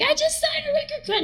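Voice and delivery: very high thrilled squeal; excited squeal. Retold or imitated. Singer bursting into the bar to tell boyfriend, squealing high.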